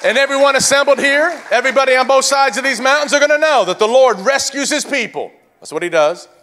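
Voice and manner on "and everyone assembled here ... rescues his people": high, loud, shouted proclamation; full-force preaching. Real, not acted. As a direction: build to a high-pitched battle cry from the pulpit.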